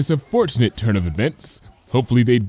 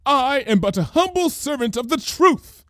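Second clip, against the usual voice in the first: high, theatrical conspiracy voice; hammed-up grand declaration; dramatic cry. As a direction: proclaim it like a caped prophet. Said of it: "cried Lyra".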